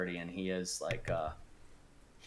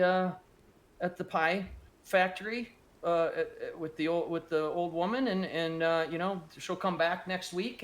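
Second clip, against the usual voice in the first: a nervous, stammering father, pitched higher; hesitant dad excusing himself, voice raised up high; poor father pleading; 6 semitones higher than usual. Acted.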